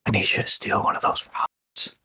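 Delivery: conspiratorial whisper in someone's ear; hushed, voiceless aside; soft whisper. Acted. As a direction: whisper a secret instruction softly. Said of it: "he's going to whisper softly in his ear".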